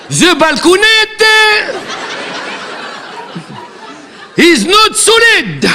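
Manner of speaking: put-on English accent, shouted; shouted mock English. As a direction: bellow the English like a ham actor. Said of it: "cet accent".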